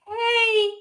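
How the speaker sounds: high, mock-tearful greeting; holding back tears. Answering husband while hiding tears, trying not to cry. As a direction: imitate covering up a cry on the phone. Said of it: "tried to make myself stop crying really fast".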